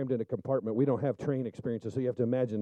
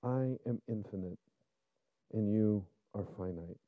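voice of God, tender; 4 semitones lower, 8 dB quieter than usual. Retold or imitated. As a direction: speak as a tender God to his creation. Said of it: "God goes".

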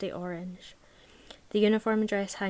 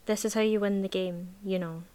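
usual speaking voice

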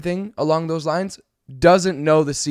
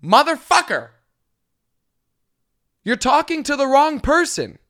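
much higher, louder exasperated cry; mock outburst; 9 semitones higher, 4 dB louder than usual. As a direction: let it burst out. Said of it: "And it's like".

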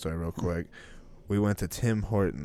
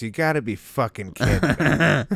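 louder exasperated complaint; 3 semitones higher, 7 dB louder than usual. Retold or imitated. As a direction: vent like you've been wronged. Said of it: "the correct word is".

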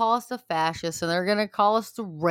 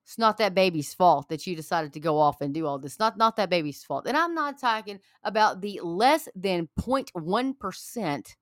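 ordinary delivery

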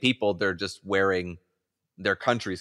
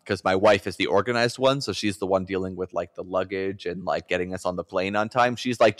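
normal talking voice

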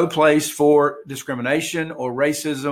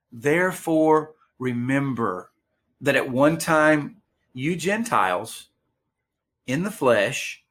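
deliberate, measured Bible reading in short phrases; slow scripture reading. Real, not acted. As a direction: read slowly, pausing between phrases. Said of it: "I'm gonna read them slowly".

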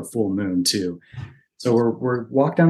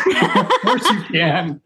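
laughing dad; 8 semitones higher, much slower than usual. Retold or imitated. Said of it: "he's like".

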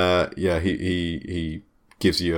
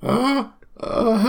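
put-on much higher, hesitant teenage clerk; gym clerk voice. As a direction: squeak out as the nervous acne-ridden clerk.

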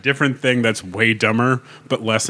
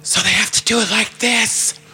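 stage whisper; 11 semitones higher than usual. Shown as a demonstration. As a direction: whisper it so the whole room hears. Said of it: "my callbacks in a stage whisper".

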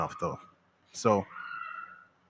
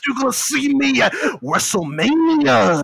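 wrestler promo; 13 semitones higher, 7 dB louder, slower than usual. Pretend. Mock wrestler threat after Macho Man.

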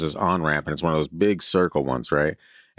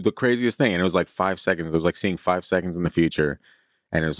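ordinary talk

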